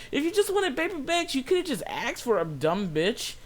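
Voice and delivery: high-pitched exasperated complaint; exasperated retort. Retold or imitated. Cashier silently fuming at customer's bag switch, exasperated. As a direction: vent at her, voice climbing way up.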